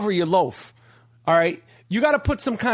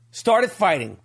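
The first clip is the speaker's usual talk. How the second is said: normal talking voice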